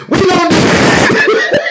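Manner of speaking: high, drawn-out laughing mock quote; mock laughing imitation. Streamer mocking own Periscope announcement, high and laughing. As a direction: stretch it out high, breaking into laughter. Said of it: "get right on Periscope and be like".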